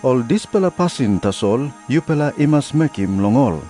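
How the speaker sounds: usual speaking voice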